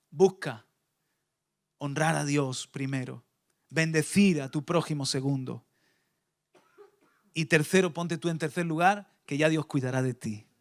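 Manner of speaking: God's calm counsel against the world; gentle voice of God. Acted. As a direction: speak like a patient loving father. Voice of God contrasted.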